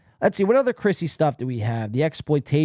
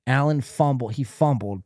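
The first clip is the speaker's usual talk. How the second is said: ordinary talk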